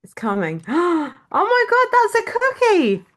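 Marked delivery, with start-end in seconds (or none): 0.7-1.3 a quick high gasp of surprise; sharp delighted gasp. Real, not acted. Author seeing her cover as a cookie, delighted gasp. Cookie picture appears on screen. 1.3-3.1 much higher and louder, squealing in amazement; amazed shriek. Real, not acted. Author seeing her book cover made into a cookie, amazed squeal.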